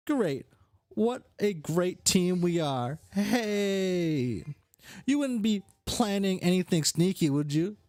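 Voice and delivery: high, falsely chummy voice; scheming brash character. Acted. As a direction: flatter a partner, then probe them.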